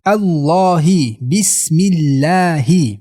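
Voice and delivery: slow 'بسم الله' with light l; thin lam. Shown as a demonstration. Teacher shows the light lam, slowly recited. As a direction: stretch 'الله' keeping the l thin. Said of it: "لم در لفظ جلاله الله باریک".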